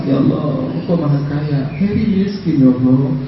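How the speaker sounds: imitating a tearful, pleading prayer; mock whining plea. Preacher retells Heri begging Allah when broke, mildly whining. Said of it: "nangis kepada Allah. Merengek".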